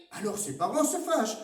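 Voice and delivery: ordinary delivery, nothing marked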